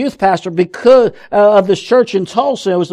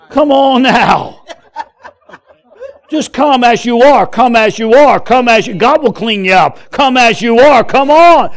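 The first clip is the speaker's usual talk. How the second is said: louder, insistent pulpit call; full-force preaching; 4 dB louder than usual. Acted. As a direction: urge the crowd to come as they are. Preacher builds to full-force appeal.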